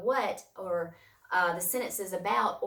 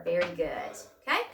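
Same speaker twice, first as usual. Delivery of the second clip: ordinary talk, nothing marked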